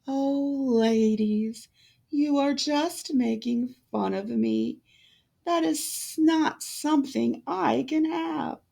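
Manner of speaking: put-on a timid girl hurt by teasing; Cinderella. A narrator's reading. Cinderella answering her mocking stepsisters, high and sad.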